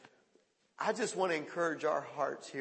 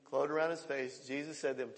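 normal talking voice

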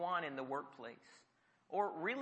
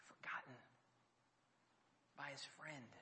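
whisper; 2 semitones lower, 17 dB quieter than usual. Acted. Repeated for effect.

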